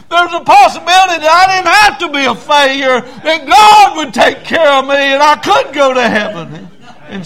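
loud, high revival preacher cry; impassioned shouted testimony; full-force preaching. Acted.

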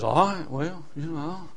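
low, soft, half-hearted consent; reluctant King Saul. Acted. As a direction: give in quietly, trailing away unconvinced.

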